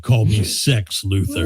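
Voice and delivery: low, slow and smooth; low seductive voice. Retold or imitated. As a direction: purr it out like a lover. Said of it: "He's like".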